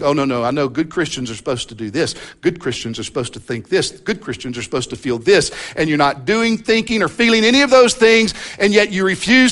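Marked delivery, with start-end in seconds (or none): 0.0-5.5 mock inner voice. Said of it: "Because you'll think".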